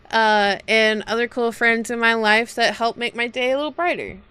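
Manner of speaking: ordinary talk, nothing marked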